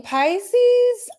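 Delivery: Pisces sung out high and loud; dramatic high call. Acted. Announcing the sign to her viewers, high squeal.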